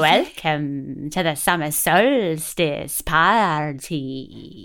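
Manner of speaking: slow, low woozy fairy; fairy after ten pints, drawn out; drunk fairy voice. Pretend. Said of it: "I hate that voice".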